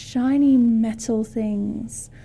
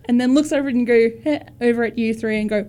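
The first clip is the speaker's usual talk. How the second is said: ordinary talk, nothing marked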